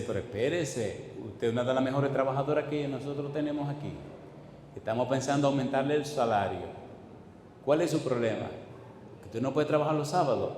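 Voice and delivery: low, hushed voice of a reasoning boss; soft persuasion. Retold or imitated. Farm owner reasons with valued worker, low and soft. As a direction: reason with her softly, pitched low.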